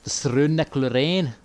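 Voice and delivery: another go at a Scottish Stronacloran; Scottish accent attempt. Shown as a demonstration. Gamer testing a Scottish accent on the name. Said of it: "What about this?".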